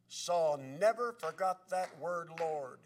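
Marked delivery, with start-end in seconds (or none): none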